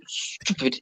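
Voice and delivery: long hiss into "stupid"; drawn-out sibilant, then the word; extended S. Shown as a demonstration. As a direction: stretch the S hard at the start of "stupid". His catchword with extended S.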